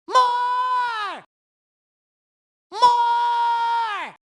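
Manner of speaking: high, long-held cry; sustained high cheer; excited call. Acted. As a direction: stretch it out high and hold it. Game character calls for more.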